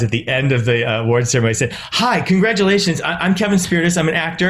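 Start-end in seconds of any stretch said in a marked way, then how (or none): 1.7-4.5 retold gushing hello, pitched up; eager introduction. Actor rushing up to an award winner, eager introduction. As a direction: introduce yourself in an eager rush, higher voice.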